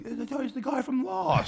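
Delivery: very high, gushing mock voice; imitated fan. Strangers mistaking him for an actor, imitated in high excitement. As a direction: squeal it like a starstruck stranger.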